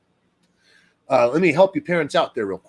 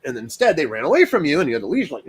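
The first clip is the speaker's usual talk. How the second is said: ordinary talk, nothing marked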